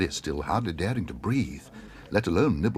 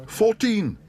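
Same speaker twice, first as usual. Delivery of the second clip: high, louder shouted bid; 5 dB louder than usual. Acted. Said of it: "shouted Paddington".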